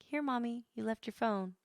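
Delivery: high-pitched mimicked kid; child's voice. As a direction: lift the voice into a small child's singsong offer. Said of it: "the kids will bring it to me and be like".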